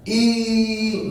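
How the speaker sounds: ई stretched long and sustained; drawn-out vowel. Shown as a demonstration. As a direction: hold the ई as a long pluta vowel. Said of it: "ई की बात कर रहा हूं". (ई को लंबा खींचकर बोलें)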